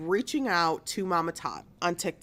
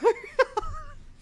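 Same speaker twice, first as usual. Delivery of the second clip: high giggle. Real, not acted. Laughing apology to viewers.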